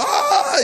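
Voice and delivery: high, loud jungle yell; Tarzan yell. Retold or imitated. Friend on the Queen's statue beating his chest, Tarzan yell. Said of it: "doing Tarzan yells".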